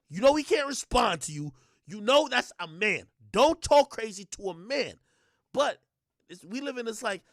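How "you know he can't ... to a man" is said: loud, emphatic acted reprimand; mock scolding. Men policing a woman who talks crazy, mock scolding. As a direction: lay down the law, loudly.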